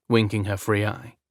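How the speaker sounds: ordinary delivery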